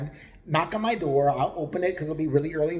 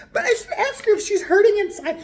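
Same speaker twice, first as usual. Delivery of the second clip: much higher, louder, put-on innocent voice; mock naive boyfriend; 7 dB louder than usual. Narrator mocking Steve's concern for the woman, high innocent voice. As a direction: play him sweet and clueless.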